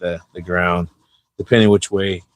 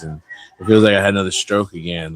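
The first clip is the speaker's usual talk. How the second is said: ordinary delivery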